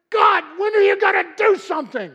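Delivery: full-voiced, high desperate shout; loud, high-pitched outcry in prayer; yelling at God. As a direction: yell it up to heaven, demanding action. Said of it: "I'm yelling".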